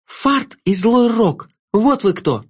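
put-on girl hisses his names at the jester; through gritted teeth. A narrator's reading. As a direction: spit the names out at him. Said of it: "сквозь зубы процедила она".

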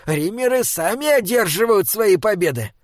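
earnest, hurried troll guardian; urgent little troll. Acted. Troll's figure voice in narration.

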